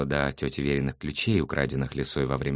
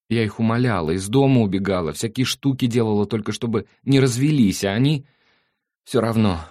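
put-on upset girl; 8 semitones higher, 7 dB louder than usual. Girl recalls begging parents.

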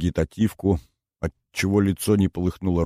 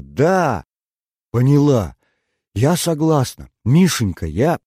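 громко, выше, с радостным напором; выпалить согласие на одном дыхании; взволнованно; 7 semitones higher, 6 dB louder than usual. Acted. (Инна радостно соглашается на условие Миши)

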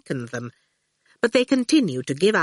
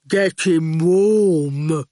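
very slow, heavy henchman voice; slow goon; 3 dB louder, much slower than usual. A narrator's reading. The goon grinning at Howard, slow and heavy. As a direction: drag each word out flatly.

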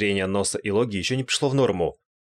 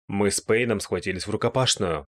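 ordinary delivery, nothing marked

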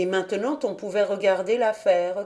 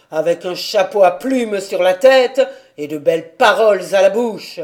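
put-on angry old man; 6 dB louder than usual. A narrator's reading. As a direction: command her harshly. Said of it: "d'une voix de tonnerre".

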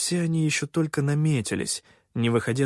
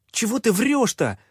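angry; 10 semitones higher, 3 dB louder than usual. Acted. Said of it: "Зло пихнула".

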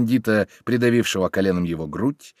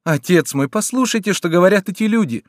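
pleading daughter; 8 semitones higher, 4 dB louder than usual. A narrator's reading. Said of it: "настаивала девушка".

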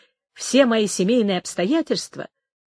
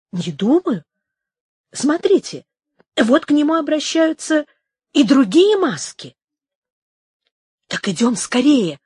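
raised, insistent voice; higher, pressing narrated dialogue; urgent urging; 5 semitones higher, 4 dB louder than usual. A narrator's reading. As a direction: press him to hurry. Duke urging haste on seeing masks.

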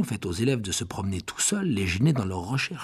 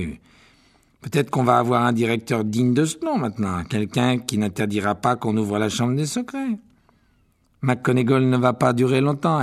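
louder, openly smug schoolboy; loud gloating; 5 dB louder than usual. A narrator's reading. Malfoy bragging aloud in class, loud smug. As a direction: make sure the whole class hears. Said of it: "sans se soucier de baisser la voix".

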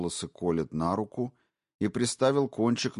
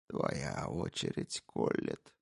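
slow, hushed, threatening; sinister whisper; 6 semitones lower, 6 dB quieter, slower than usual. Acted. Intruder turning on the wife, slow menacing whisper. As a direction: turn the knife to her. Brogan's voice.

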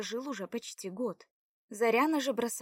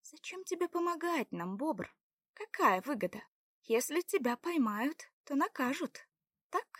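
higher-pitched wary questioning; suspicious; 4 semitones higher than usual. A narrator's reading. Заряна questions his reason for helping, suspicious. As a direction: probe his motive warily. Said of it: "подозрительно спросила Заряна".

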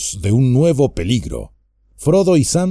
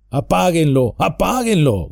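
higher, urgent mild shout from inside the tree; 5 semitones higher than usual. Acted. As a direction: yell at them to put it out. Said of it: "gritó Merry".

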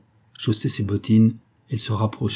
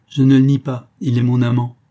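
defiant; 3 semitones higher, 8 dB louder than usual. A narrator's reading. Wife admits her lover to the commissioner, defiant. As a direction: declare it boldly and without shame. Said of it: "Elle prononça crânement".

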